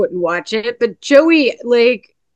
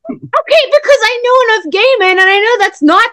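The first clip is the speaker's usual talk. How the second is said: high, defensive outburst; defensive protest; 7 semitones higher, 3 dB louder than usual. Real, not acted. Defending the question to teasing friends, defensive protest. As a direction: protest in a raised pitch. Defends herself after being teased.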